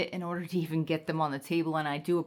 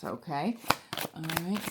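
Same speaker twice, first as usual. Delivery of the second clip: ordinary talk, nothing marked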